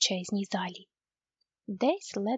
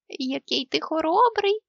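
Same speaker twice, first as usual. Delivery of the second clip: ordinary delivery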